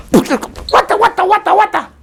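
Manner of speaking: dog confused by a sudden bang; startled dog. Retold or imitated. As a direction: play the dog jolted mid-dig.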